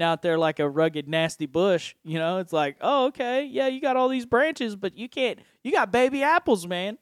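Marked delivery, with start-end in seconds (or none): 2.8-7.0 higher, teasing put-on voice; mock approval. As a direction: tease the unpruned tree, pitched up. Mocking an unpruned bush.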